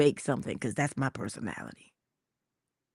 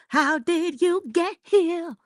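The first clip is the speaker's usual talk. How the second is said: high, slow diva sing-song; lightly sung diva question; 11 semitones higher, slower than usual. Acted. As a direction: channel a soulful diva asking. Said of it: "let's pull a [name]".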